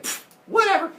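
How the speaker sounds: high, loud mock scoff; scornful dismissal. Angry Semele before slapping Zeus, scornful. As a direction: brush him off loudly.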